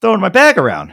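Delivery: high, loud mock alarm; yelling whoa at a stranger; alarmed shout. Locker room guy yelling at him, loud and high. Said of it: "He's like".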